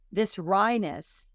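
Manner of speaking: normal talking voice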